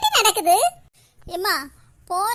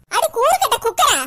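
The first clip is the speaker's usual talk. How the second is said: loud, higher exasperated insult; angry name-calling; 4 semitones higher, 5 dB louder than usual. Acted. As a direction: hurl the silly insult in a fury. Mother hurls insult at wailing daughter. (கோபமான வசவு)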